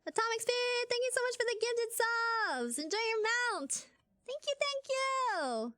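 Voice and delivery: high, bright, grateful thanks; excited thanks. Real, not acted. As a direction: gush thanks at the gifter, slowing at the end. Thanking gifted subs.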